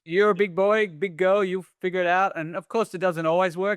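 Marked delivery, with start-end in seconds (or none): 0.0-2.3 a touch higher, lightly voiced cheerful donor; mildly hammed-up carefree encouragement; breezy encourager. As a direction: toss it off like handing over cash without worry. Voicing the cash-giving charity.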